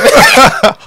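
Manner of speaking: sudden burst of high, loud laughter. Real, not acted. Guest laughing at the host's potato joke, loud high laugh.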